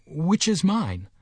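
high, slow, apprehensive question; gasped. A narrator's reading. Mister Button asks nurse for his baby, gasping. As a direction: ask it haltingly, dreading the answer. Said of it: "gasped Mister Button".

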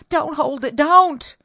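high, loud, urgent; frantic plea. Acted. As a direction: beg him to drop it. Wife begs husband drop veil.

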